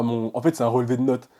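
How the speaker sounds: ordinary delivery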